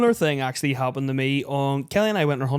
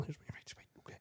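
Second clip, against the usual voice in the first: whisper; 27 dB quieter, faster than usual. Retold or imitated. Nervous aside about being naked.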